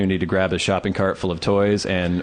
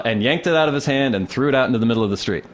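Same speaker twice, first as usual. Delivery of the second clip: ordinary talk, nothing marked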